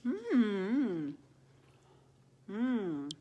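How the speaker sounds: chewing a huge bite; humming through a full mouth. Real, not acted. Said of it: "My mouth is full".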